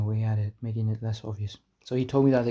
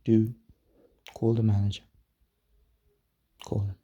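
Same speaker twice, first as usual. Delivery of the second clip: lightly voiced stern dad, measured pauses; a hint of firm father voice. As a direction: lay down the law like a dad, understated. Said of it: "I turned on my dad voice".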